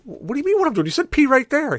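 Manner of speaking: high-pitched retold squabbling; squeaky-high mock indignation; indignant protest. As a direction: protest back in a high, baffled voice. Indignant reply to brother's yell.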